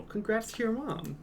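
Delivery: cheerful high-pitched congrats, leaning on "your"; high excited congrats. Real, not acted. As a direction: lift "your" high and bright. Reacting to chat news.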